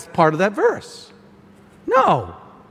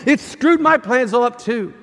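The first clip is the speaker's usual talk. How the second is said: Jesus sarcastically urging him to keep complaining, higher; sarcastic agreement; 6 semitones higher than usual. Acted. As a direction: tease the complainer with a mock yes. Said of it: "he's sitting there and he's going".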